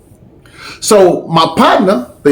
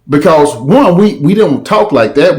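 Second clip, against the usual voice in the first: usual speaking voice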